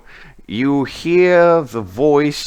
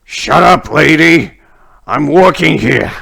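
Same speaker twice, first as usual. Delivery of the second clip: grumpy, raised irritated shout; 4 semitones higher, 5 dB louder than usual. Acted. Worker telling off the lady who interrupts him, grumpy shout. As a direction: snap back loudly, a bit higher. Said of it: "you can hear a grumpy voice".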